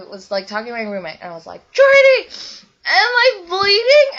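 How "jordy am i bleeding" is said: very high, loud panicked shriek; freaking out. Retold or imitated. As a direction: scream to your roommate in panic. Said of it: "And I was freaking out".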